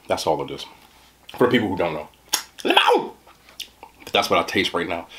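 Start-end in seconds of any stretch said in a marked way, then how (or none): 2.6-3.1 Limon as a high [name] yelp. Retold or imitated. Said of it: "made me think of [name]".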